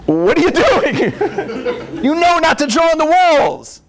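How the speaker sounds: dad scolding son for drawing on walls; mock scolding.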